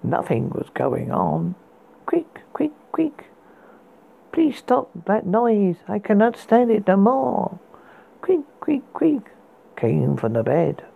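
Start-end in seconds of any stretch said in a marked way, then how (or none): 2.1-3.2 high thin creak sounds; high squeaky creak. Acted. Creaking bed sound imitated. 4.3-7.6 exasperated. Acted. Exasperated pleading at noise. 8.2-9.2 high thin creak sounds; high squeaky creak. Acted. Creaking bed sound imitated.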